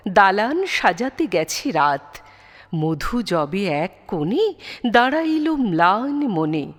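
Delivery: usual speaking voice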